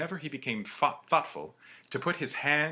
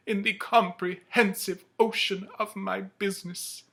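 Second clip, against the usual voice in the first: remorseful ghost; 11 semitones higher, 9 dB louder than usual. Acted. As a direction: lament your own wasted life. Ghost laments.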